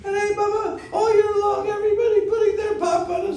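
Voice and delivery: shrill chorus of complaining goddesses; high mock-female wail; river goddesses. River goddesses complain to Nanda Baba.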